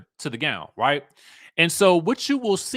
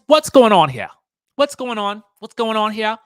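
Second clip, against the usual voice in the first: loud, raised disbelief at the dress; exasperated "what's going on here", pitched up; incredulous loud; 5 semitones higher, 9 dB louder than usual. Real, not acted. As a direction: call out what's going on, louder and higher. Scolding the dress, babes.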